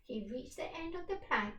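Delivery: ordinary delivery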